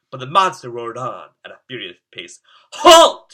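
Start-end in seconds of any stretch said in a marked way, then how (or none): 2.7-3.3 loud, high shout of halt; shouted command. Acted. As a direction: bark the order to stop the monster. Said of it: "shouted Kevin".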